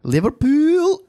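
high-pitched put-on Scouse; Scouse accent. Shown as a demonstration. Co-host asked for a Scouse accent, hammed-up Scouse. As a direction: exaggerate the Scouse, rising at the end. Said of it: "Why don't you give us your Scouse accent".

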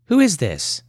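put-on a bright little girl answering the phone; child answering the phone.

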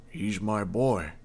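a father's voice catching on his son; choked with emotion. A narrator's reading. The mayor pleading for his dying son, voice choking. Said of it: "his voice suddenly choked with emotion".

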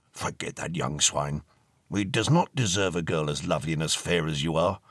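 put-on courtly old ghost comforting the girl; old gentleman ghost. Ghost voice held in story.

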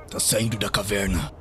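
ordinary talk, nothing marked

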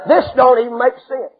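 ordinary delivery

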